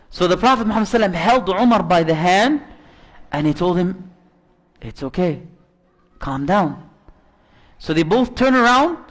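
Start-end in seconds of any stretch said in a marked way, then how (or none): 4.8-6.9 much softer and lower, soothing; gentle calming. Retold or imitated. Prophet hushing Umar gently to calm his anger. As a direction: settle him down with a hush.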